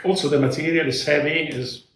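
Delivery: ordinary delivery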